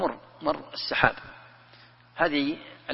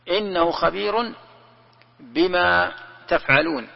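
Quran recitation; 4 semitones higher, slower than usual. Acted. Verse recited slowly.

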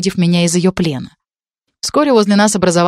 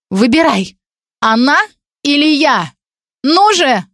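high, pushing harder each word; frantic ultimatum; 8 semitones higher, slower than usual. Acted. Girl holding a knife, forcing Dan to choose, frantic. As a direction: demand an answer right now.

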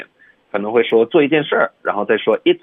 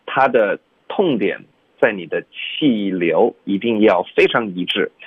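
ordinary delivery

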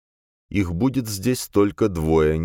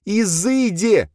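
high, loud drawn-out wail; piercing, slow exorcising yell; piercing shriek; 5 dB louder, much slower than usual. A narrator's reading. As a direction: shriek it slowly to banish him. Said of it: "пронзительно завопила Долорес О'Тул".

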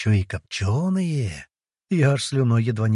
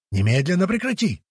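higher-pitched, restrained yelled command; Poseidon yelling; 7 semitones higher than usual. A narrator's reading. Poseidon ordering the mage to stop, mildly yelled. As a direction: demand it higher without much added volume. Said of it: "продолжал вопить он".

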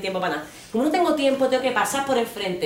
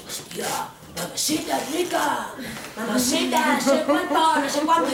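a lightly voiced leering catcall, rising higher; catcaller; 6 semitones higher than usual. Mimicking men catcalling her in El Salvador, leering. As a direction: drawl it like a street catcaller.

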